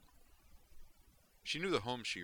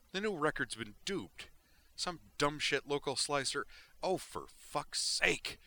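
higher, heated cursing rant; outraged rant; 6 semitones higher, 4 dB louder than usual. Acted. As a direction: vent at the dumb slicer. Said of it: "let out an outraged yell".

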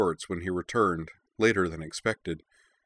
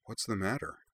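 gentle, soft concern; softly; 3 semitones higher, 6 dB quieter than usual. A narrator's reading. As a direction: ask it tenderly and quietly. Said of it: "then asked him softly".

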